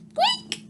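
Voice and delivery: tiny, very high squeak; mouse squeak. Acted. Mouse gets on, tiny very high squeak. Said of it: "said Mouse".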